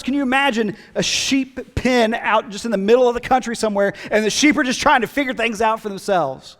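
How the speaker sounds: fervent preacher growing to a loud, high call; preaching at full force. Acted. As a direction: build each line like a pulpit climax.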